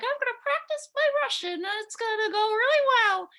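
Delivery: high, bright self-mocking voice of an eager teen; naive eager younger self. Retelling her teen self at the bookstore, high and eager. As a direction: chirp it like an overconfident eighteen-year-old. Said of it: "and I'm like".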